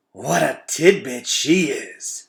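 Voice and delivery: slow, savouring rat purr; smooth, rich voice. A narrator's reading. Fangor the rat admiring the toddler, smooth and rich. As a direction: make it sound delicious and smooth. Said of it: "in a smooth, rich voice".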